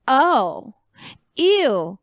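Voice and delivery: imitating a mildly disgusted snub, words spaced apart; mock disgust. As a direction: act out a drawn-apart, put-off refusal. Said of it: "what they heard was".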